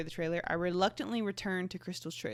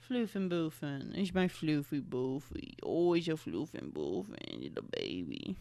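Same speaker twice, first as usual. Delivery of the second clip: softer, lower pet talk; lower tender coo; 4 semitones lower, 6 dB quieter than usual. Real, not acted. Owner murmuring pet names softly to her cat.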